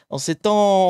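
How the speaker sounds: over-eager loud stream greeting; shouting a bit. Real, not acted. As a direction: project it a little too hard into the mic. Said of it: "Je gueule un peu".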